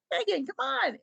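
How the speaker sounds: pleading whine. Retold or imitated. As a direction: whine her name like a plea. Mother pleading with daughter.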